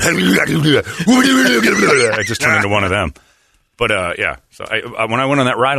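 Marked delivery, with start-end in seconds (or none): 0.1-2.2 wild cackle. Retold or imitated. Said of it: "and I just start going".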